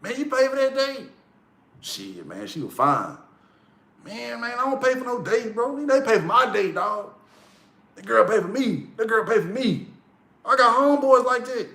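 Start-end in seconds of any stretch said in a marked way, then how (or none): 0.0-1.1 high-pitched incredulous homeboy; mock dogging out. Homeboy grilling his friend about a date, mock incredulous. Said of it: "They will dog you out". 1.8-3.2 low, soft excuse; mumbled defence, imitating the teased friend; sheepish retold reply. Friend excusing himself for paying, mock sheepish. 4.0-9.9 high-pitched boasting homeboy; mock dogging out. Homeboy mocking a friend who paid, high and scornful. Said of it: "They will dog you out".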